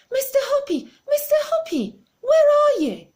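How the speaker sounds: high, loud shrieking call from below; thrilled neighbour calling up from the street, high and loud; excited shout. Acted. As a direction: shout up to him, shrill with excitement. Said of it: "she was shouting".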